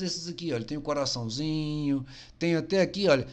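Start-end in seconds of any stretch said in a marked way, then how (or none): none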